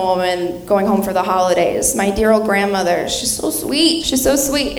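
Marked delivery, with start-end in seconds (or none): none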